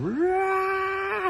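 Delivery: high piercing mock scream; sudden high war cry; shaman's scream. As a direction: shriek like the shaman. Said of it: "she's going".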